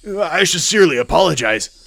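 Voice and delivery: a touch of the nervous, placating underling; flustered apology. Acted. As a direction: apologize hastily to an angry superior. Chief apologizes to angry congressman.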